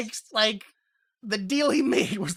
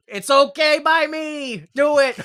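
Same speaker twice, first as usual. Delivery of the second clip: higher, drawn-out retold shout; loud brush-off; 8 semitones higher, 8 dB louder than usual. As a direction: wave them away at full volume. Acting out Steamboat's exasperated brush-off.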